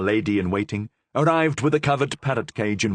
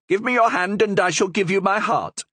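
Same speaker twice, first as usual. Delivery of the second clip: high, loud bird voice; parrot speaking English; 12 semitones higher, 4 dB louder than usual. Acted. Parrot answering in English, high bird voice. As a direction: squawk the line like a parrot.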